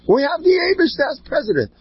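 high, ringing proclamation; exultant declaration. Acted. Preacher telling congregation God is president, high and exultant. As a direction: announce it like the only answer.

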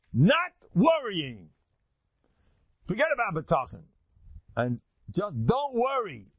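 "not worrying" drawn out high and loud; each word of "not worrying" stretched and punched; emphatic advice. Retold or imitated. Acts out the Mekubal's emphatic advice.

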